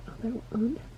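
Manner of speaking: quick hushed whisper. Real, not acted. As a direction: whisper it so she won't hear.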